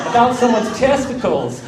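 loud, high mock announcement; public announcement. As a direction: imitate a host calling out to the whole room. Speaker announces it to the room.

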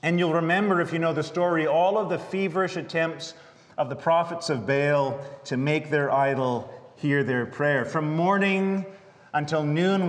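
ordinary talk

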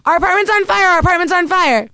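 high, urgent fire alarm call; panicked alarm. Retold or imitated. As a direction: wake the roommates in panic. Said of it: "I'm like".

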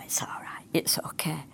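unvoiced whisper, half to herself; whispered reassurance to herself while laughing inside. Real, not acted. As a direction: breathe it out, amused and soft. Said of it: "I laugh inside".

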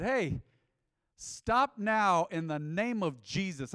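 loud, slow command in Jesus' name; preacher rebuking the ringleader, loud and slow; commanding rebuke. Retold or imitated. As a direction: stop him dead with authority.

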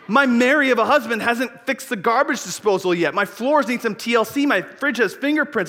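high, loud, mock-panicked rant; flustered host. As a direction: act out a host panicking over an unprepared house. Acts flustered host expecting Jesus.

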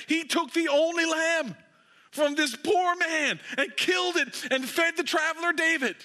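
pitched high with mounting outrage; rising outrage. Acted. Preacher telling the congregation of the stolen lamb, rising outrage. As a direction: build the injustice to a peak. Climax of Nathan's story.

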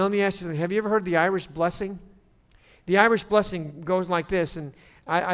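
normal talking voice

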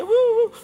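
high, loud "woo" of approval; playful whoop. Real, not acted. Pastor cheering keto followers in the room, playful whoop.

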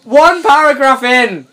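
high, loud, slow, laughing disbelief; incredulous outrage. Real, not acted. Outraged rant at the author.

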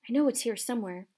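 ordinary delivery, nothing marked

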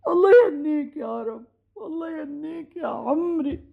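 tearful blessing, voice breaking; choked-up, moved to weeping; crying. Acted. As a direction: bless them through tears. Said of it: "ليش عم تبكي".

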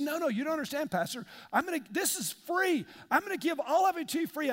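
high, eager protest; eager insistence. Retold or imitated. Radio friend insisting to the pastor, high eager protest. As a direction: brush the objection aside with excitement.

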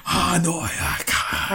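hammed-up reluctant Welsh hero, slowing; mock anguished refusal. Doolittle refusing her plea, mock anguished. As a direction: sigh it out, trailing into breath.

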